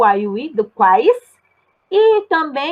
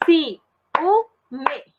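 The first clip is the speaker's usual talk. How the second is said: "Ciúme" split into ci... ú... me; teacher separating the syllables of "Ciúme"; 2 semitones higher than usual. Shown as a demonstration. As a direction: sound out "Ciúme" slowly, pausing between syllables. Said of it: "como eu falo".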